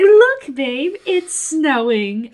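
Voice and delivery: very high, loud mock squeal, drawn out; wife's excited announcement. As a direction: imitate a wife thrilled to show off snow. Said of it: "And she goes".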